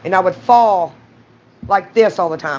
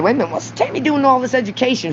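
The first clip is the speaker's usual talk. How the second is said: mock puzzled; 6 semitones higher, faster than usual.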